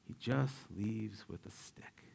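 slow unvoiced whisper for effect; dramatic whisper. Real, not acted. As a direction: let the ending land in a hush. Dramatic close of story.

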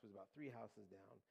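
ordinary talk, nothing marked

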